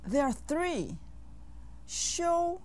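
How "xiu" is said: Xiu held high and level; first tone. Shown as a demonstration. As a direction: say Xiu on a long, even high pitch. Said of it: "with the first tone".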